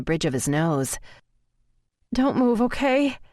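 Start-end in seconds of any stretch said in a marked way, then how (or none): 2.1-3.2 high, emotional plea; anxious request, pitched high and breaking. A narrator's reading. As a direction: plead with a catch in the voice. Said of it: "My voice breaks when I say it".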